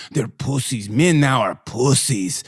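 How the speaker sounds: put-on low, scornful Ukrainian accent; imitated Ukrainian accent. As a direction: imitate her dismissing men in a Ukrainian accent. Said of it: "act like I was imitating her voice".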